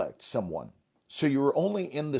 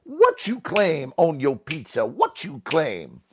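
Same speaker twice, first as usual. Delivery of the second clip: "What you claim" high, loud and swinging; game-call inflection; 4 semitones higher, 5 dB louder than usual. Shown as a demonstration. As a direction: punch out "claim" like a rhythmic call. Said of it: "that kind of inflection".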